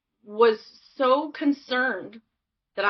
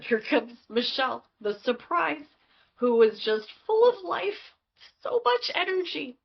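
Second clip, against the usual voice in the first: high, bubbly self-announcement; mock-grand announcement; 7 semitones higher than usual. Guest presents her childhood self as the family surprise, playfully excited.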